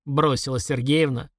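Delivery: plain reading voice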